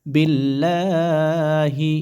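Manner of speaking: বিল্লাহি with a thin, light lam in Allah; drawn-out বিল্লাহি with a light l. Shown as a demonstration. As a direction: say বিল্লাহি slowly, keeping the l thin and not heavy. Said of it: "আল্লাহ শব্দ পাতলা হবে".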